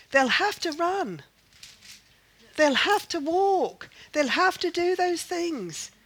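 high, measured, insistent preaching; emphatic preaching. Real, not acted. Preacher explaining what the weary must do, emphatic.

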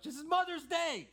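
high, loud outburst; exclaimed protest. Real, not acted. Preacher reminding church it's Mother's Day, loud exclamation. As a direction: cry it out to the whole church. Mock protest at own fervour.

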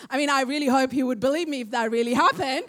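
ordinary delivery

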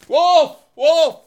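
high, loud mock alarm; hammed-up wolf call; crying wolf. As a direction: shout wolf like the boy in the fable.

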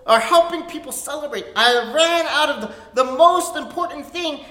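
loud, high panicked cry over running out; panicked host. Retold or imitated. Wedding host panicking to guests, loud and high. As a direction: play the host realizing the wine is gone. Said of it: "He's like".

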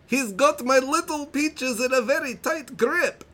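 high-pitched mock Italian accent; over-the-top Borat-esque Italian accent; hammy Italian-Borat accent. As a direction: read it in a ridiculous Italian accent going into Borat. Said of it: "I'm gonna read it in the best accent that I can".

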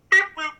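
high electronic "Beep, boop"; heart monitor beep. Retold or imitated. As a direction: imitate a hospital monitor beeping.

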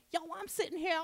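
squeaky imitation of a Chinese woman; mock Chinese accent. Telling a friend about a seat, mock Chinese accent. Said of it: "That's exactly what she sounded like, Chinese".

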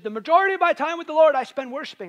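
imitating a South African woman, high and loud; South African accent. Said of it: "in her South African accent".

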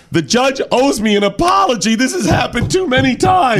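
high-pitched parody of a wronged complainer; mock indignant whine. Bank robber complaining about the judge, mock indignant. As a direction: protest with hammed-up outrage.

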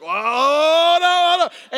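long, loud, high wail of "oh no"; mock dismay wail. Husband panicking at the contraction graph, mock wail. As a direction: wail it out like a comic siren. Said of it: "I'm just like".

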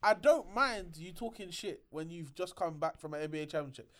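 ordinary talk

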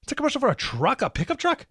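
high-pitched mock disbelief, rising into a question; incredulous reaction. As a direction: act out a baffled viewer's high, questioning reaction. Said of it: "And you're like".

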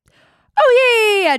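high, loud excited squeal; excited shriek. Real, not acted. Spotting the Panama boy out surfing, excited squeal. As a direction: squeal with delighted surprise.

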